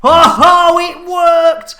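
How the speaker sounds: high, loud whoop of success; triumphant. Pretend. Host seeing the tot toss worked, jubilant.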